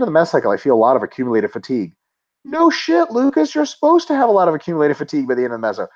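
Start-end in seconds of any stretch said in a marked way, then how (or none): 2.4-4.5 high, incredulous "no shit"; exasperated. Real, not acted. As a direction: blow up in disbelief. Exasperated reaction to listener question.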